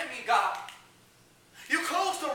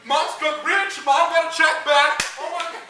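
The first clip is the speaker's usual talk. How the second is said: very loud, thrilled exclaiming; excited kid; 3 semitones higher, 10 dB louder than usual. Retold or imitated. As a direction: burst out with childhood glee. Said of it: "that was like".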